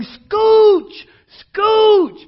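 very high, very loud repeated scooch; frantic yell. Retold or imitated. Guide screaming at terrified students, frantic shout. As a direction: scream it at a stuck raft. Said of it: "the guide is yelling".

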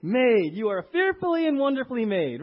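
declaring the birthday verse to the church; proclaimed verse. Acted. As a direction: preach it out loud and high. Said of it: "quoting the verse with force".

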